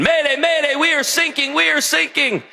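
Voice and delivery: high, urgent mock radio mayday; imitated sinking sailor, pitched high; mayday distress call. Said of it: "and a mayday comes in".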